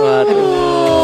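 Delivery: high, startled waduh; surprised cry. Real, not acted. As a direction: yelp it in shock. Reacting to sudden kill.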